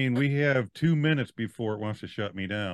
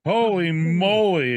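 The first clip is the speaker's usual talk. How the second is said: drawn-out exclamation; 6 semitones higher, 6 dB louder, much slower than usual. Real, not acted. Exclaiming slowly.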